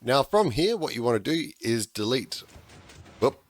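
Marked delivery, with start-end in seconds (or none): none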